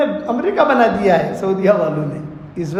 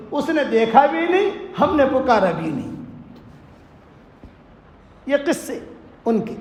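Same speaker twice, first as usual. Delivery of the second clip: ordinary talk, nothing marked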